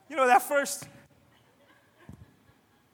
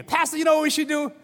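higher, louder, keen mock enthusiasm; eager pitch; 7 semitones higher, 7 dB louder than usual. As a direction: pitch it to the pastor all fired up. Eager member pitching an idea.

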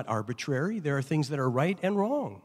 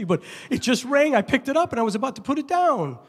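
high, loud, rushed excuse; flustered excuse; 11 semitones higher, 5 dB louder, faster than usual. Retold or imitated. Driver pleading with the officer, high and rushed. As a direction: babble like a caught driver.

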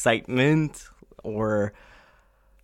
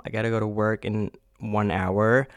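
ordinary delivery

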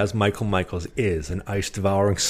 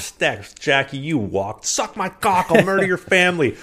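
much higher, furious screaming tirade; angry rant; 10 semitones higher than usual. Acted. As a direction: scream threats at the ref. Acting out angry coach rant.

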